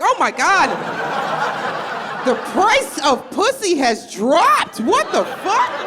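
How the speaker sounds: high, loud shocked squeal at a student; high scandalized cry, half laughing. Retold or imitated. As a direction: shriek it in disbelief at Darius. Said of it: "I was like".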